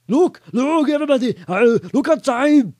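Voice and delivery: high, loud excited alert; urgent calling out. A narrator's reading. Muldoon calls everyone to the window.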